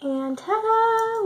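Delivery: high, slow, stretched ta-da; ta-da reveal. Real, not acted. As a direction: show off the big reveal. Reveals the finished makeup look.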